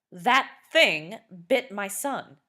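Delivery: slow, loud, furious bellow. A narrator's reading. Neighbour accusing Missus West, slow loud bellow. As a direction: roar the accusation at the door. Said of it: "Mister Worthington bellowed".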